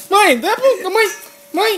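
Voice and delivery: high, loud call to mom. Real, not acted. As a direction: call across the room. Calling mother over noise.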